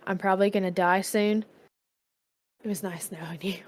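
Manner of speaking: lightly hushed, as if into a friend's ear; whisper in his ear. Pretend. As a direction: say a quiet farewell, fading almost away. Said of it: "I whisper in his ear".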